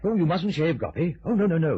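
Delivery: alarmed. Acted. Alarmed at razor plan.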